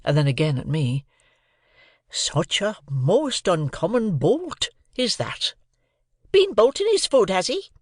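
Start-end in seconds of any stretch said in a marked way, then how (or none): 2.1-5.5 slow, confiding Joe; slow confidential Joe. A narrator's reading. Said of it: "speaking in a confidential voice". 6.3-7.7 much higher, louder cry as the sister; sister's sharp cry; cried out. A narrator's reading. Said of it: "cried my sister".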